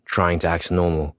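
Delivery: ordinary delivery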